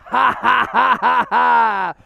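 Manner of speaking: loud taunting ha-ha; big gloating cackle; mocking laugh. Real, not acted. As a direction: laugh right in the rival's face.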